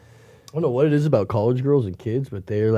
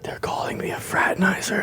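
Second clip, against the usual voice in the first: whispered complaint; 7 semitones higher, 8 dB quieter than usual. Retold or imitated.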